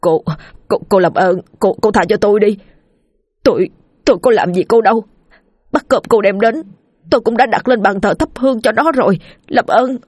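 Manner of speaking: frightened, stammering begging; trembling plea. Acted. Narrator begs the ghost to spare him, trembling. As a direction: plead haltingly, voice rising with panic. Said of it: "run rẩy lên tiếng".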